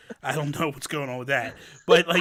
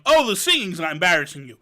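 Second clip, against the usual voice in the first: loud teasing; 6 semitones higher, 7 dB louder than usual. Retold or imitated. Teasing Jeffrey about his embarrassment.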